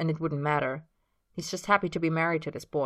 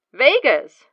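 shocked disbelief; 8 semitones higher, 12 dB louder than usual. Acted. James shocked.

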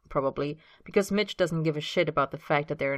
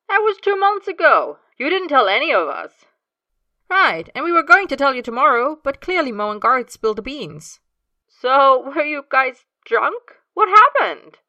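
high, loud, frantic questions; panicking over the news; distressed; 9 semitones higher, 11 dB louder than usual. Acted. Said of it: "James sounds distressed".

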